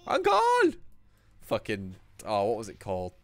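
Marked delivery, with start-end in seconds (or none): 0.1-0.7 high shouted cartoon call; cartoon quote shout. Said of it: "Jackie Chan Adventures".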